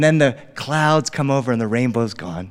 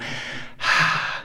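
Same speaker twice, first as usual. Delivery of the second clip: slow calming sigh; soft released breath; long breathy exhale; 6 dB quieter than usual. Acted. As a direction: let the breath out to settle down. He breathes out as told.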